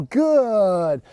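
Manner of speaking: "good" held long on a falling glide; drawn-out marker word. Shown as a demonstration. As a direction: stretch "good" out slowly, easing down. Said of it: "convey the thought to the animal".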